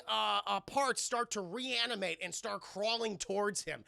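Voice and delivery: normal talking voice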